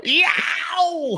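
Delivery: high-pitched imitated robot yelp; Star Wars robot yell. Co-host mimics a film noise, robot yell. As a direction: squeal it like a panicking movie droid. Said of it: "the Star Wars robot yell".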